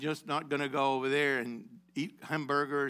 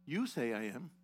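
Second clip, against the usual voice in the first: quiet answer; 4 semitones lower, 9 dB quieter than usual. Acted. Yeshua's answer to loud questioner.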